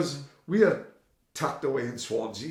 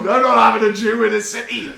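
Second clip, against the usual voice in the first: high, loud, laughing mock outrage; mock bigot joke; 8 semitones higher, 10 dB louder than usual. Mate joking about banishing the customs guy, laughing. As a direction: ham it up as a fake bigot, laughing.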